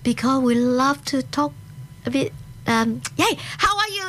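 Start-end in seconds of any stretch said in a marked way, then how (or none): 3.2-4.0 high-pitched mock excited chatter; too excited. Chatty friends greeting at an event, imitated over-excitement. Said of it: "too excited".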